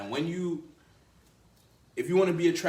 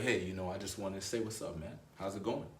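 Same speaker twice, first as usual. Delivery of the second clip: calm respectful greeting; 5 semitones lower, 7 dB quieter than usual. Retold or imitated. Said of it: "if he holds his frame".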